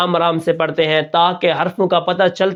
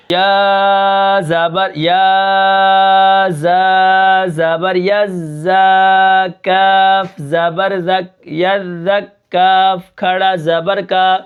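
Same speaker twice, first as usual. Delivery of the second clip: slow spelling drill; much slower than usual. Shown as a demonstration. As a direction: spell it out for the children. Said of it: "اب یہاں پر دیکھیں". (بچوں کے لیے آہستہ ہجے)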